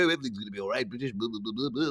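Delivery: babbled mock complacency; terrible mocking impression. Mocking the host for sitting there, burbled impression. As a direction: mimic someone blithely burbling on. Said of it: "That was a terrible impression of me".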